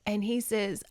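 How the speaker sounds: ordinary talk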